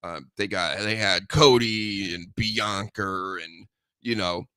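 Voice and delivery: normal talking voice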